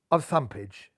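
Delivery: ordinary delivery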